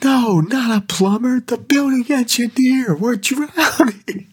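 very high, strained panicked voice, slowing at the end; mock a boss in total panic; panicked squeal. Boss demanding the building engineer, shrill mock panic. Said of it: "he's like".

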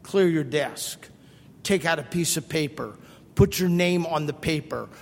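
low, dry classroom instructions; teacher's instructions. Retold or imitated. Teacher giving the same test routine each class, low and dry. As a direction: rattle off the routine like every teacher. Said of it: "the teacher said".

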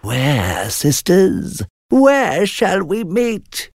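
very high, slow cackling witch. Acted. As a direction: drag it out like an old hag. Witches voiced.

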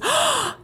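sharp high mock gasp of discovery. Mom imitating her daughter's sudden gasp. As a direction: gasp like a kid who just figured it out.

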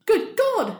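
high, loud outraged cry; shocked exclamation. Acted. Lady Susan scandalised by Catherine's charge, loud shocked outburst. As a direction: gasp it out, scandalised. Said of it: "she exclaimed".

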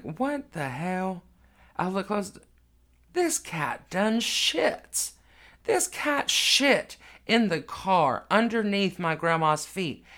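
can't believe what you're seeing; shocked disbelief. Real, not acted. Realizing the cat pooped.

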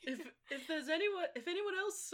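high, halting mock-tearful voice; lightly acted crying father. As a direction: choke up as you try to speak. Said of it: "when he cries at the very end scene and goes".

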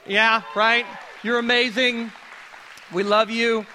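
loud cheer for the moms; rally the room into applause; hyping the crowd. Real, not acted. Preacher cheering the moms to the crowd, loud. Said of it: "Let's give it up to moms".

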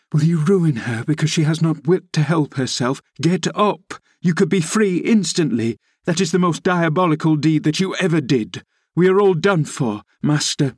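high-pitched, agitated rebuke; passionate scolding. A narrator's reading. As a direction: scold him in desperate urgency, pitch climbing. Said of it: "I said passionately".

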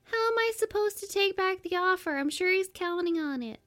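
high, thin character voice; put-on heroine voice. Host voices the heroine's worried question, high-pitched. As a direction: pitch it way up for her lines. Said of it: "Yeah, do the voices".

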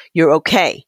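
usual speaking voice

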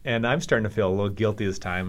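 ordinary talk, nothing marked

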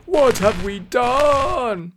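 high, slow, drawn-out wail; dismayed cry. A narrator's reading. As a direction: lament it with melodramatic dread. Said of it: "Prestgar cried".

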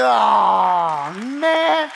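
comic shocked shout; shocked outcry. Retold or imitated. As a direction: cry out in disbelief, hamming it up. Retelling his shocked reply to pastor.

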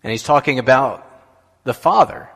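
normal talking voice

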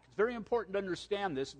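normal talking voice

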